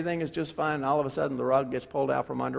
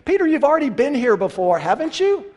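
higher, louder, fast scolding; chiding Peter; 10 semitones higher, 8 dB louder than usual. Acted. Preacher scolding Peter for repeating his mistake. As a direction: wag a finger at an old friend.

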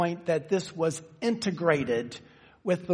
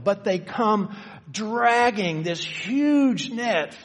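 dragging drawn out slow and raised high; stress on dragging; 5 semitones higher, 3 dB louder, much slower than usual. Shown as a demonstration. Preacher lingers on dragging for the congregation, slow and raised. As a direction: stretch the word dragging, slow and lifted. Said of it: "I hope I emphasized the word dragging enough".

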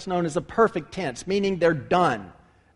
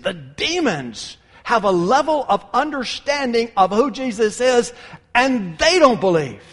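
fervent preaching; 6 semitones higher, 6 dB louder than usual. Acted. Preaching at full force.

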